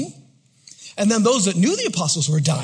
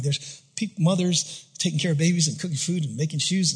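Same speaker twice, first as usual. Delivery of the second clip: ordinary talk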